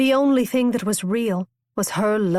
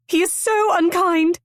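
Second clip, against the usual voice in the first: high, louder tearful complaint; 11 semitones higher, 4 dB louder than usual. A narrator's reading. Constance weeping to Rosecroft, tearful and high. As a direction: wail it through tears.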